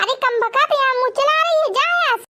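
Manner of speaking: sharp-tongued mother-in-law yelling for her bahu, lightly played; mildly voiced scolding mother-in-law.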